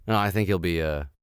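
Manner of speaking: usual speaking voice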